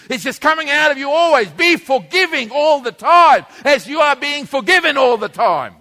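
rising fervent sermon shout; preaching at full force. Acted. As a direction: drive it home like a fiery preacher.

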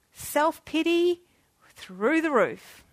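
usual speaking voice

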